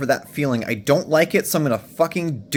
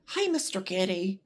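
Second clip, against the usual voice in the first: higher, much softer cooing to the cat; sweet cat greeting; 6 semitones higher, 8 dB quieter than usual. Real, not acted. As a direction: coo hello to the kitty. Greeting cat entering bedroom.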